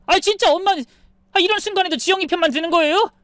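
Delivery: high, fast exasperated complaint; whiny protest. Acted. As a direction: wail at mom, feeling betrayed. 아들이 엄마에게 항의. (서럽게 빠르게 따지는 아들)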